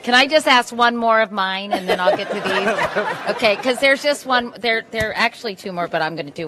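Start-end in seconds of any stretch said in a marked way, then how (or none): 0.0-4.5 laughing. Real, not acted. Moderator laughing, asks one more.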